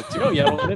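parroting his friend's "違う、やろうね", squeaking through a laugh; mimicking a dialect phrase. Mimicking his friend's dialect ending, laughing high. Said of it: "やろうねって言う".

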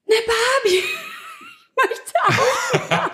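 hohe, lachende Kinderstimme. Retold or imitated. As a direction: quengelndes Kind, hoch und lachend. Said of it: "hab immer gesagt". (quengelnde Kinderstimme)

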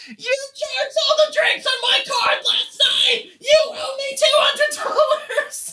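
high-pitched, shouted impression of an angry girl; hammed-up furious demand at top pitch; angry mock shout. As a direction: yell it in outraged imitation, way up high. Angry girl demanding money back.

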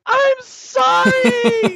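high, slow wailing sob; a child's shrieking, tearful sorry; crying apology. Retold or imitated. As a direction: wail it out like a sobbing toddler. Toddler finally apologizes while screaming.

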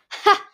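single loud, high scornful "ha"; barked laugh. A narrator's reading. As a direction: bark out one mocking laugh. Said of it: "Winter barked".

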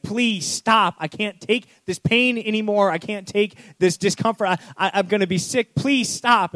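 high, pleading cry to stop; pleading clay. Acted. Clay begging the potter to stop, high and pleading. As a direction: beg the potter in anguish.

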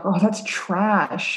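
put-on very slow, bored teen dismissal; mock teen drawl. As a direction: stretch it out like a jaded kid. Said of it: "they're always like".

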